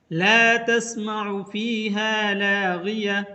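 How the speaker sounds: slow, melodic tajweed chant; धीमी तिलावत की आवाज़; Quran recitation. Shown as a demonstration. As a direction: recite it slowly like tilawat. Recites Quran verse as example.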